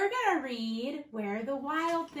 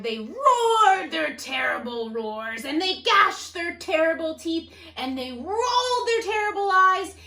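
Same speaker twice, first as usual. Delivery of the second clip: roaring; 7 dB louder than usual. Acted. Reading the wild things' threat, loud monster roar.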